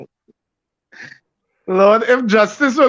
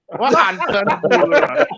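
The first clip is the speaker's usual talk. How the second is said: laughing; 4 semitones higher than usual. Real, not acted. Mocking the rival's prediction.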